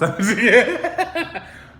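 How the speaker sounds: loud high burst of laughter; laughing. Real, not acted. Laughing after joking sign-off.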